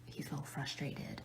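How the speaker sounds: hushed whisper so he won't hear. Real, not acted. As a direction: keep it to a breathy secret whisper. Whispering while husband works nearby.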